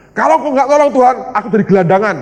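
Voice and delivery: high, loud retold outcry; bold plea to God. Preacher telling God he will be homeless, loud and high. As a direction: throw the challenge up to God at full voice. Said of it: "Ngomong sama Tuhan gini".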